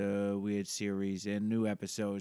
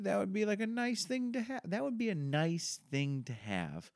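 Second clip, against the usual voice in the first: high put-on voice; 11 semitones higher than usual.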